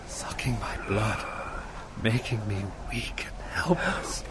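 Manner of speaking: breath-only, fading nightmare whisper; weak whisper. Acted. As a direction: whisper as if drained of strength. Nightmare, being drained.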